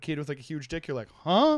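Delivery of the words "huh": high, loud mock double take; startled reaction. Imitating a kid seeing something huge, loudly startled. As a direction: let it jump up in surprise.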